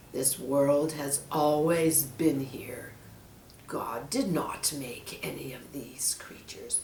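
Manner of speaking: menacing snake. Acted. Snake hints at the monkey's fate, low. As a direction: let the threat hang quietly.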